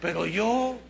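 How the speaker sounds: normal talking voice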